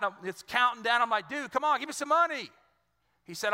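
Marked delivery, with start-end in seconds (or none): 1.3-2.5 higher-pitched, pressing mock plea; urgent plea. Boy begging his friend for money before the countdown ends, urgent. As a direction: act out a kid begging in a hurry.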